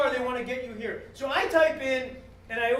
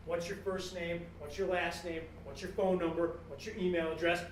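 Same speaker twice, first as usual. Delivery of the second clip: low, subdued questionnaire voice; lower, softer, impersonal list of questions; rote form questions; 5 semitones lower, 6 dB quieter than usual. Acted. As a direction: reel them off like an automated form. Voicing a web form's questions.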